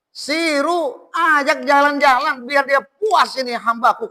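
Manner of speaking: lightly voiced divine command, high and emphatic; God's command. Voices God commanding the angels.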